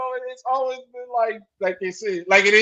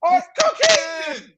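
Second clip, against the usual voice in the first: mock shouted question; 6 semitones higher, 6 dB louder, slower than usual. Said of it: "I would've looked up instantly like".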